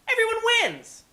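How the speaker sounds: high cheerful exclamation; excited. Acted. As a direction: sing it out brightly. Cheery sign-off to camera.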